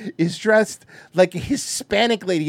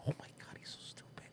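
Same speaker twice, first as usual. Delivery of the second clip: whispered aside; 24 dB quieter, faster than usual. Real, not acted. Aside about the kid.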